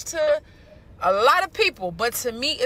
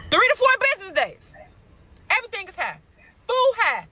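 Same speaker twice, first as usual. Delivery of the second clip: higher, fed-up listing of prices; exasperated rant; 3 semitones higher than usual. Real, not acted. Woman venting to her viewers about prices, exasperated. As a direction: pile up each price in disbelief.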